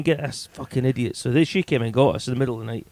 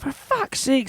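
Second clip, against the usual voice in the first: high exasperated mock girlfriend; lightly voiced exasperation; high groaning complaint; annoyed girlfriend; 11 semitones higher, slower than usual.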